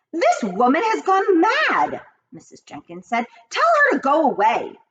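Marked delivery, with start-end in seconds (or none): 0.1-2.0 much higher, louder, slow outraged lady; a snooty mother scoffing at the old woman; high, slow outraged socialite; indignant wife. A narrator's reading. Mrs Jenkins indignant. 3.5-4.8 put-on a snooty mother scoffing at the old woman; indignant wife. A narrator's reading. Mrs Jenkins dismissive.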